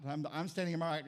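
normal talking voice

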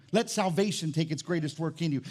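ordinary talk

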